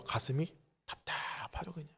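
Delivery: whispered, weary and tight in the chest; stifled whisper. Acted. Pastor to congregation, a frustrated half-whisper. Pastor sighs over hard prayer.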